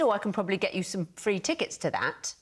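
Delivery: normal talking voice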